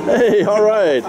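ordinary talk, nothing marked

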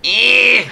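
high, loud, drawn-out noise of doubt; loud high-pitched hedging groan; doubtful "eh". Real, not acted. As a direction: squeal a long, unconvinced "eh". Doubtful verdict on Omar Epps.

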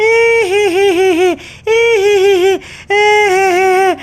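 hammed-up wailing sobs; mock sobbing. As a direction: blubber it out like a sulking girl. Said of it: "তুমি কাঁদছো কেন?".

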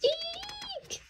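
shrill, very high squeal of fright; high eek scream. A narrator's reading. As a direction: shriek it at the top of the range. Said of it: "screamed Potts".

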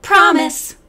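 quick, loud, eager "promise". Acted. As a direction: blurt it out keenly together. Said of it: "they said in unison".

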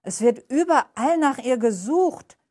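surprised doorkeeper. A narrator's reading.

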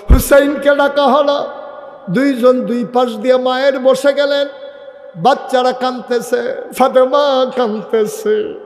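high, mournful chanted narration; impassioned preaching. Real, not acted. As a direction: let the deathbed story ring out in grief. Preacher dramatizes deathbed scene.

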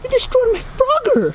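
very high, wounded protest; outraged accusation. Real, not acted. Accuses the other over Frogger, outraged.

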